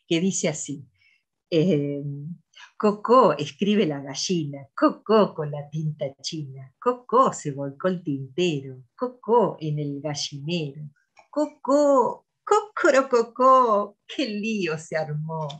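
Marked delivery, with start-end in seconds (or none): 2.8-14.0 lively sing-song read-aloud with high clucking cocó calls and animal noises; reading to children. Acted. Teacher reading a farm poem to children, playful sing-song. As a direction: read it to little kids with high hen calls and barnyard sounds.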